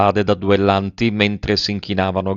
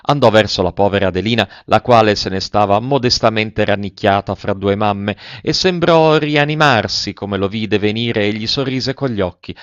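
usual speaking voice

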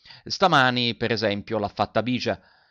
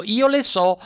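high, loud, smiling boast; smug retort; 10 semitones higher, 7 dB louder than usual. A narrator's reading. Votini boasting to the teacher, high loud smugness. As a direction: show off to spite a rival. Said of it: "con un sorriso come per fare una picca".

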